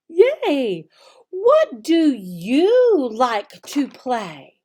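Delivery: slow, exaggerated teacher voice, brighter on questions; slow teacher modeling. Shown as a demonstration. Teacher modeling the lesson for children, slow and clear. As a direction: model each sentence slowly and clearly for little kids. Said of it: "See, simple".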